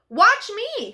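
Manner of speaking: showing off. Acted. Froggy showing off.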